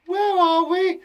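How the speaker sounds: very high, loud question; Jenny. Acted. Jenny asking a question, very high loud voice. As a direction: ask it in a piercingly high voice, rising. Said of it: "she's asking a question".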